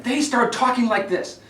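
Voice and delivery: tight, strained debate delivery; tense, pressed voice. Shown as a demonstration. Coach showing a tense candidate, strained and pushing. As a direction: push every word with tension. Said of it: "Notice the tension in my voice".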